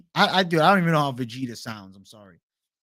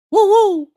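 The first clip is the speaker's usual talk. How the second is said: Woo woo; 10 dB louder than usual. Retold or imitated. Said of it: "Tai Tuivasa".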